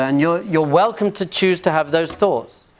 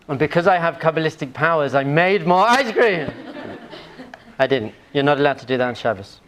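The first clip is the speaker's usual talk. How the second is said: normal talking voice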